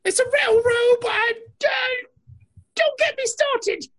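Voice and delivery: high put-on British voice; a huffy Brit defending his robe; mock British. Defending his robe to a friend, high mock British accent.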